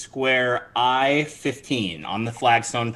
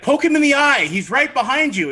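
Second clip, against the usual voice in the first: high urgent hissed advice; NPC urging; 12 semitones higher, 4 dB louder than usual. Acted. GM voicing NPC helping player escape grab, high urgent. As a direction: urge the escape, much higher. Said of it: "He's like, he's like".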